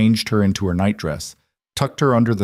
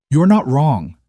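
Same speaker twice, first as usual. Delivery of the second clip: fierce; 6 semitones higher, 5 dB louder than usual. A narrator's reading. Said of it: "Leanne said fiercely".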